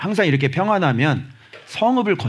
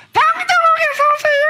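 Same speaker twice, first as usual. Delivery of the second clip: far higher, loud comic squeal; comic high shout; 5 dB louder than usual. Acted. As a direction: squeal it out for a laugh. Shrill comic exhortation.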